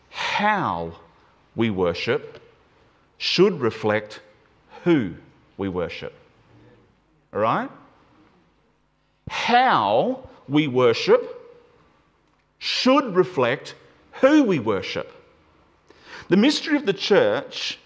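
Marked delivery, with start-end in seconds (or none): 0.1-6.1 "how" set apart by a pause, lower pitch; deliberate phrases, pauses isolating "how" and "who"; stress on how. Shown as a demonstration. Preacher backing up to emphasize the word, stress on "how". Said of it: "emphasize the word again". 9.3-15.1 slow, "how" stretched out, "who" leaned on; stress on how. Shown as a demonstration. Preacher repeating the point to the church, stressing "how". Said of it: "emphasize the word again".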